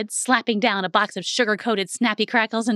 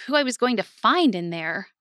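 plain reading voice

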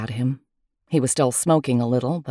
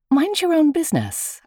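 much higher, sharp put-down; sharp retort; 12 semitones higher than usual. Acted. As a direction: push him off with a high sharp snap. Snapping at the devil.

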